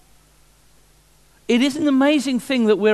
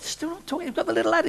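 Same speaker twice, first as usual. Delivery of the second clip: surprised shopkeeper; 10 semitones higher, 5 dB quieter, much faster than usual. Acted. Shop owner voiced, surprised.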